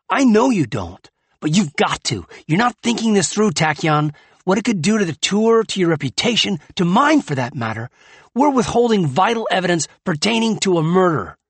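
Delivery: loud, high exasperated anger; heated. Acted. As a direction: hurl it at him. Heated argument in story.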